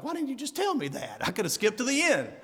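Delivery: high pitched mock exasperation, ending in a laugh; exasperated rhetorical quote. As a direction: play the baffled reader, half laughing at yourself. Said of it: "And I'm like".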